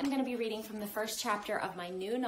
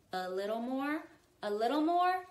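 coaxing; 6 semitones higher, 5 dB louder than usual. A narrator's reading. Bong guiding Somkit under the mango, coaxing. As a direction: nudge him along, growing eager.

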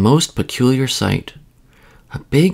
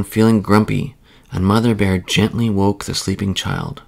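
usual speaking voice

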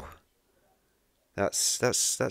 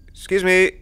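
loud call; 7 semitones higher, 6 dB louder than usual. Real, not acted. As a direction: excuse me called out much louder and higher. Calling to man in cupboard.

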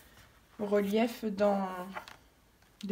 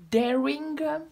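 "Daring gum" in a higher, louder mock English accent; hammed-up English accent; 6 semitones higher, 6 dB louder, slower than usual. Playing to her giggling daughter in a hammed-up English accent. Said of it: "Je me la fais avec l'accent anglaise".

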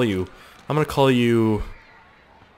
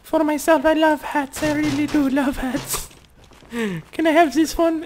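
a high, slow silly customer voice; squeaky customer voice. Pretend. Asking the shopkeeper for hats in a very high silly voice.

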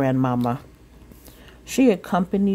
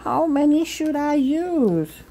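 much higher, earnest little voice; innocent child question; 11 semitones higher than usual. Acted. Sophia asking mother, high and earnest. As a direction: ask sweetly, eager to comply.

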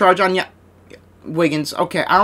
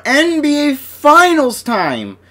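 much higher, slow, drawn-out announcer call; announcer hype; 10 semitones higher, much slower than usual. Acted. Opening the finals for viewers, a slow high hype call. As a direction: sell it like an arena announcer.

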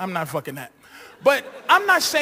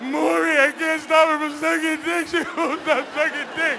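mock crying mother; 6 semitones higher than usual. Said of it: "the mother's in the back crying".

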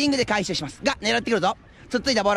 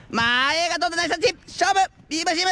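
rising excitement; 6 semitones higher than usual. Real, not acted. Attack building to a shot.

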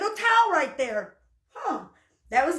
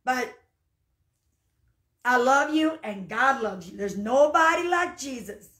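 ordinary talk, nothing marked

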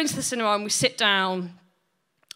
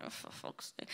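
whispered under breath; muttered aside; 23 dB quieter than usual. Retold or imitated. Son reacting privately to mum's offer, whispered. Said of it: "I was like".

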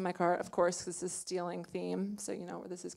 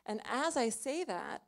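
ordinary delivery

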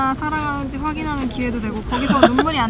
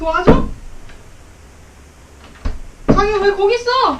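high, loud call from another room; calling out loudly; 7 semitones higher, 5 dB louder than usual. Real, not acted. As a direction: shout for her from afar. Calling out for partner.

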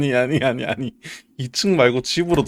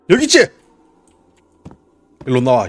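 shouting a taunt; loud taunt; 9 dB louder, faster than usual. Real, not acted. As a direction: dare it to come out. Taunting game monster.

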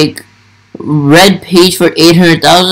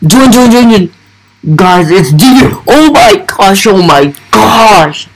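urgent, high excited chant into amazement; real fan excitement, voice pitched up; 4 semitones higher than usual. Real, not acted. Fan rushing to join the YouTuber's game, excited.

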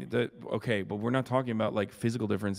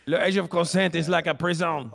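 put-on higher, thicker French accent; 7 semitones higher, 3 dB louder than usual. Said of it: "it's more of a Quebecois accent".

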